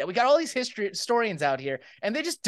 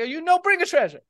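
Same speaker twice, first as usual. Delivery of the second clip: Italian Pope; 5 semitones higher than usual. Acted. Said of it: "he's like".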